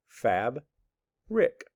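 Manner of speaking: FAB, pause, RICK, as two separate parts; split into syllables. Shown as a demonstration. Teacher reading word parts, one syllable at a time. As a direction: read fab and rick apart, a clear gap between. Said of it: "I have two parts of the word".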